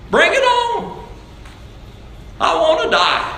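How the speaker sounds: very high-pitched mimicked bravado; high, hammed-up welcoming cry; mock eagerness. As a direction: imitate someone absurdly eager, voice leaping up. Mimics brethren welcoming death.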